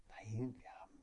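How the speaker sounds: soft secret whisper; whispering on the phone. Retold or imitated. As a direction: whisper so nobody hears. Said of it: "warum flüsterst du so?". (leises Flüstern am Telefon)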